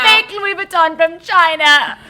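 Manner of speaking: loud high laughing reality-TV quote; Davide impression. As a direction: yell the famous put-down. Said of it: "That was Davide".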